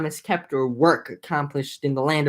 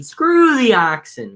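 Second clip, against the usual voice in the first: exasperated outburst; 5 semitones higher, 4 dB louder, slower than usual. Real, not acted. Joking outburst at text.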